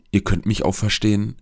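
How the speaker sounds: ordinary delivery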